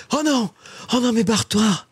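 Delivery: high-pitched protest; dismayed squeal. Real, not acted. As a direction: cry out high at the fleeing prey. Prey escapes after the hit.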